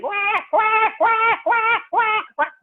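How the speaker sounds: storyteller imitating a baby's wail; baby crying. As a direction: wail wah wah like a newborn. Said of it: "still the baby is crying".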